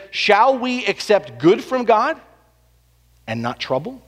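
slow, measured quote of Job; quoting Job slowly. Retold or imitated. Pastor quoting Job to the congregation, slow and measured. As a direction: lift Job's question slowly to the room. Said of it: "He says".

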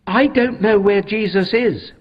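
high, loud and urgent plea; pleading, desperate to find him. Acted. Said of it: "Mary said urgently".

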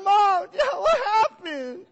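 high, loud wailing plea; hammed-up childish sob-wail; mock crying. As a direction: wail it like a heartbroken kid. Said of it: "I started to cry and weep".

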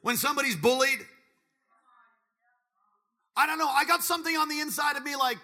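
high, loud fervent preaching; impassioned sermon climax; preaching at full force. Acted. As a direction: drive it home to the youth.

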